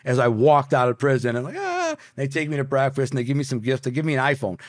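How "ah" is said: high-pitched thin "aah"; mock scream. Retelling the walk out of prison, high mock scream.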